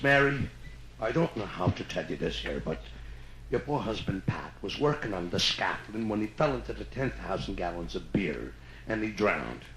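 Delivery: low, soft Irish brogue; Irish accent. Acted. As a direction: break the sad news gently in Irish. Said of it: "I gotta do an Irish story".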